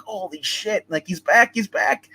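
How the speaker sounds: a mother who sees the killer is back; panicked. Retold or imitated. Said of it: "she starts freaking the fuck out".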